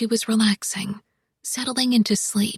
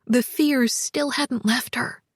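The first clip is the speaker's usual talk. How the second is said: usual speaking voice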